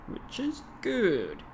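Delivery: drawn-out mock English, "Which is good" slow and high, falling; slow hammed-up English accent; slow put-on English accent. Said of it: "rather silly attempt to perform some English-speaking kind of an accent".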